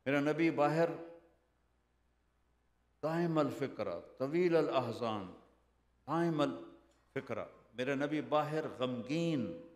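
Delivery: loud, rising sermon cadence; fervent preacher lifting the crowd, loud; preaching at full force. Acted. Impassioned preaching about the Prophet.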